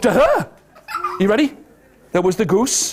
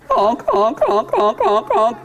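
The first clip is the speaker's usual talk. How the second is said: goose honks; slower than usual. Shown as a demonstration. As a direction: honk honk like a goose. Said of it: "What does a goose say?".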